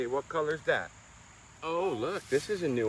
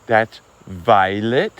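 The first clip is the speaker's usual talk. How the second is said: slow, loud, rising on violet; teaching a word; 12 dB louder, much slower than usual. Real, not acted. Parent teaching toddler a new colour, slow teaching voice. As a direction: draw out "violet" clearly with a lift at the end.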